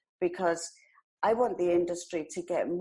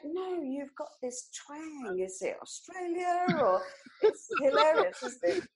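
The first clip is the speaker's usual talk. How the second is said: imitating the English; 9 semitones higher than usual. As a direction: draw it out like a puzzled Brit. Imitating English people on her twang.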